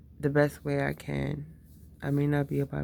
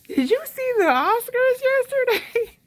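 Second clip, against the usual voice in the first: very high, loud, slow put-on chirp; mock small talk; 10 dB louder, slower than usual. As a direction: squeal it like hammed-up chitchat. Parody of shallow small talk.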